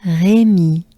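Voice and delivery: "Rémy" with French R and held E ending; long final ee on French Rémy; French name demo. Shown as a demonstration. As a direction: model Rémy with a banana smile on the last vowel. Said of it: "banana smile".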